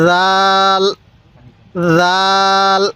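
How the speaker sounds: রাল opening on a soft voiced th; gentle dhal sound for রাল, held long; soft pronunciation. Shown as a demonstration. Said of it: "এই রালটা নরম করে উচ্চারণ করব".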